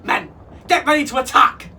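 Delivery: loud, high pirate command; rally the men with a shout; captain calling the crew to arms; battle order. A narrator's reading. Captain orders his men to attack.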